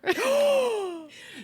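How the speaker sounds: big, high indignant gasp; dramatic gasp. Real, not acted. Host finds the show isn't free anymore, outraged gasp. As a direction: suck in a loud, scandalized breath.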